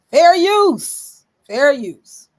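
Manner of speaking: fair use shouted high and loud. Shown as a demonstration. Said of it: "Say it again. For the back room".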